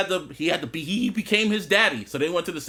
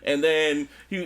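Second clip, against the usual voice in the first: ordinary talk, nothing marked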